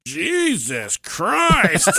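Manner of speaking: put-on high, drawn-out little-kid Jesus Christ; four-year-old exclaiming. Four-year-old daughter reacting to the full container, slow kid voice. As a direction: stretch it out like an unimpressed toddler. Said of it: "and she goes".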